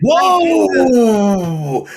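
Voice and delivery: high, startled cry of surprise; a sudden high yelp; shocked whoa. Real, not acted. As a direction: blurt it out in disbelief. Shocked reaction to glue story.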